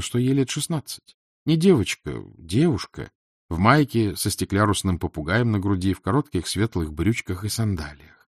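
usual speaking voice

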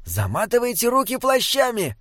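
urgent instruction in a high-pitched voice; small companion Binnebik. A narrator's reading. Narrator voices the character Binnebik.

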